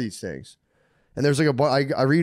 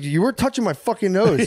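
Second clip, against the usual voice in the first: indignant; 8 semitones higher than usual. Retold or imitated. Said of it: "I was like".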